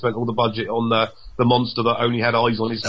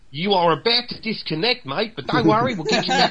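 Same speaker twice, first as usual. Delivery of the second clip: lightly hammed-up Australian accent, a little higher; mock Australian accent; 6 semitones higher than usual. Friend teasing a mate in a mock Australian accent. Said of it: "would it have been more like".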